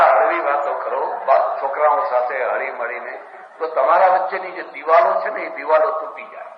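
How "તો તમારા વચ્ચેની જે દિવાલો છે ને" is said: ordinary talk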